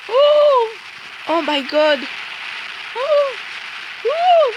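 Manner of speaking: squeaky shocked oh; voice cracking. Real, not acted. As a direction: voice breaking high in shock. Shocked by glitch in game.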